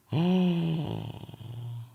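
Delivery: drawn-out amazed gasp; long gasp. Real, not acted. Coder seeing the fix finally work, long gasp.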